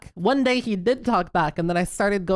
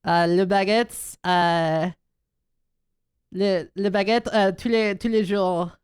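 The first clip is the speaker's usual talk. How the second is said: slow French with an American accent; slower than usual. Shown as a demonstration. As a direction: speak French like an American tourist. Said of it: "I'm the French one".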